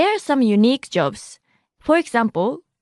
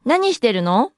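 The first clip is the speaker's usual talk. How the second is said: 何してるの？ with the final の lifted upward; rising intonation. Shown as a demonstration. Teacher models asking a friend, 何してるの？ rising at the end. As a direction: lift の at the end of 何してるの？ into a question. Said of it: "Note the rising intonation".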